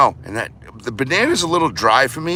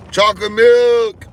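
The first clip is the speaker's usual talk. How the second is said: excited announcement; 9 semitones higher, 6 dB louder than usual. Pretend. Excited showing of drink.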